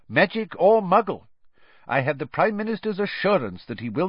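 high-pitched, testy official; irritable. A narrator's reading. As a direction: justify yourself irritably, pitched well up. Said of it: "said an irritable Fudge".

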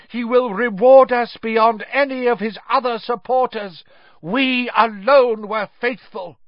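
high, loud, fanatical proclamation; defiant call. A narrator's reading. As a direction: call it out proudly to the judge. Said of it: "looked up at Crouch and called".

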